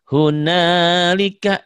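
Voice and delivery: hunalika chanted slow and high; teacher modelling Quran reading; Quran recitation model. Shown as a demonstration. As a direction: model hunalika slowly with long vowels. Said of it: "Diulang, Bu".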